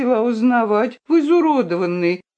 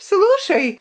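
high, sharp shout from the princess; the spoilt princess shouting; girl's shout; 12 semitones higher than usual. A narrator's reading. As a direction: cut in with a high cry. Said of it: "крикнула Виолинка".

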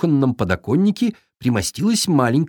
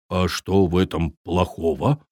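surprised viking; 6 semitones lower, 3 dB quieter than usual. Acted. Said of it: "удивился Таппи".

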